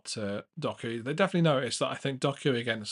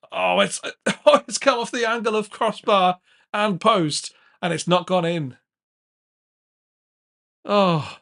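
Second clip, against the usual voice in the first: excited shout; 8 semitones higher, 11 dB louder than usual. Real, not acted. Shot hits crossbar and post.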